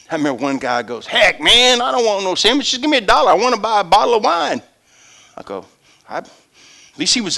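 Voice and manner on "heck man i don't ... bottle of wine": high, brash beggar voice; a street drunk turning down the sandwich. Retold or imitated.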